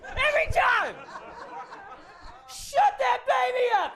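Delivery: ranting passenger losing it; angry passenger. Retold or imitated. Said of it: "And you're like".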